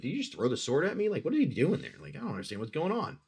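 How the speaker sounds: a hint of indignant confusion; lightly voiced baffled bug. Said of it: "he was looking at you like".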